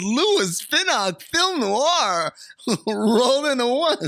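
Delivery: high, slow mock Southern accent; laughing through a put-on Southern voice. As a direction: stretch it out in a hammed-up Southern drawl. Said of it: "Southern accent".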